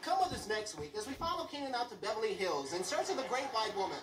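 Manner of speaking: nature documentary host voice; Marlon Perkins narrator. Retold or imitated. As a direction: announce it like a wildlife show. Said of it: "Like Marlon Perkins".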